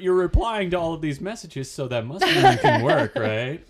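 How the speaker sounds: high, laughing mock reasoning; teasing laugh. Real, not acted. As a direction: tease like a sly boss. Teasing mock boss logic.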